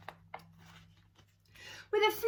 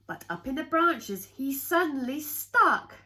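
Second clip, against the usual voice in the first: dramatic rise; 6 semitones higher, 5 dB louder than usual. A narrator's reading. Reading to kids, building to the cat getting stuck, dramatic. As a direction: voice jumps up high and louder on the sudden stick. Climax line read with drama.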